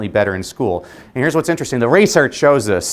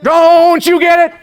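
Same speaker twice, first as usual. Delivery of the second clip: booming, drawn-out preacher cry, very high; Martin Luther King Junior; 8 dB louder, slower than usual. Retold or imitated. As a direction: stretch it out like a boy orator at full force. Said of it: "Howard spoke like Martin Luther King Junior".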